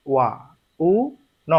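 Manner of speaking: "ওয়া", "উ", "ন" spaced out as three separate syllables; said slowly. Shown as a demonstration. Teacher showing students the slow reading, broken into syllables. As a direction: pull "ওয়া উ ন" apart slowly, one sound at a time. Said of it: "আর যদি যে slowly বলি".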